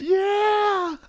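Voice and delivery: high, long whale cry; good whale imitation. Whale agreeing with his translator, high drawn-out wail. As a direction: hold the word like whale song. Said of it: "that's a really good whale".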